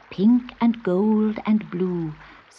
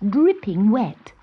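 usual speaking voice

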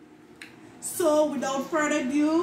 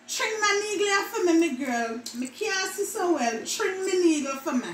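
higher, asking a favour in patois; Jamaican patois; 4 semitones higher than usual. Shown as a demonstration. Older person asking a girl to thread a needle, patois. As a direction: ask your girl for help, Jamaican style. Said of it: "words that Jamaican mispronounce".

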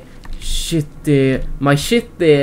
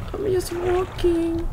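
much higher, slow and soft, like a tiny creature; squeaky high voice; 12 semitones higher, 7 dB quieter, slower than usual. Pretend. Gamer repeats his line in a tiny high voice. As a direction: squeak it out, small and timid.

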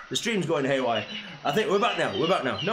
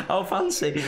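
ordinary delivery

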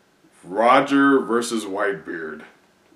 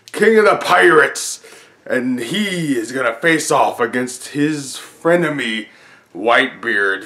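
booming ring announcer; talking like a pirate; 6 semitones higher, 4 dB louder than usual. Pretend. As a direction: hype the crowd like a boxing announcer. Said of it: "I'll stop talking like a pirate".

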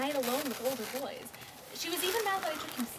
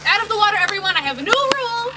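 loud, higher shouted order; calling out; 7 semitones higher, 14 dB louder, faster than usual. Acted. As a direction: command the kids out of the water. Ordering kids out of water.

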